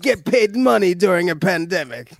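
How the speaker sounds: high-pitched bad German accent; terrible German accent. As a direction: do a badly faked German accent. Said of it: "that was a terrible German accent".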